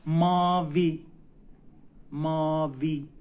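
MA-VI loud, syllable by syllable; شمرده و آموزشی. Shown as a demonstration. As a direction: say ماوی loud as two long, clear syllables, MAA-VII. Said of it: "یعنی آبی".

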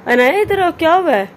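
calling to daughter. Real, not acted. Calling daughter, asking what's wrong.

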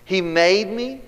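high, slow, gleeful delight; a joyful man marvelling that God made him; excited psalmist. Acted. Preacher voicing the psalmist's joyful excitement. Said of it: "the psalmist is getting excited".

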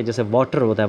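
ordinary talk

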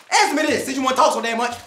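usual speaking voice

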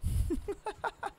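panicked laugh. Real, not acted.

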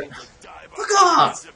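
sudden high angry yell; angry outburst. Real, not acted. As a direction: snap it out at the enemy. Shouts suddenly at a game enemy.

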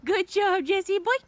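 high, loud good job; high excited dog praise. Real, not acted. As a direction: cheer the dog on.

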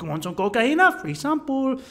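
lightly voiced Colombian tout; Colombian street hustler. Hustler offering a sample again, lightly imitated. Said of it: "and guy's like".

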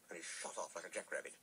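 ordinary delivery, nothing marked

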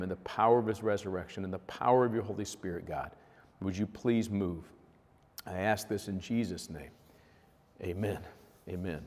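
hushed closing prayer. Real, not acted.

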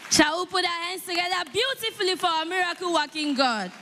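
high, lifted call to the congregation; rally the room to clap for God; rousing call to applaud. Real, not acted. Host urging the congregation to clap, rousing high call.

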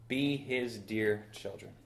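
ordinary talk, nothing marked

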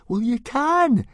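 put-on boy insisting stoutly. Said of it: "said Fishlegs stoutly".

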